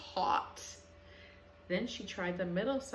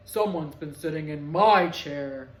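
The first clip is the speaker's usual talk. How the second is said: lower, booming papa bear; 4 semitones lower, 11 dB louder than usual. A narrator's reading. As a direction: boom it out like a big papa bear. Papa Bear voiced in story.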